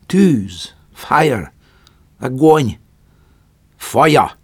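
loud, high shouted words; emphatic naming. A narrator's reading. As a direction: spell out fire to them loudly. Prometheus teaching the word fire. (Prométeusz hangosan magyarázza a tűz szót)

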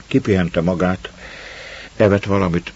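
ordinary delivery, nothing marked

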